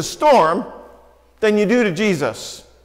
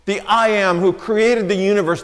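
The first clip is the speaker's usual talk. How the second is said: normal talking voice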